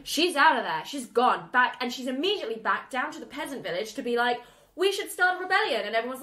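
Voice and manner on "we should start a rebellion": rousing heroine. Retold or imitated. Heroine rallying the village.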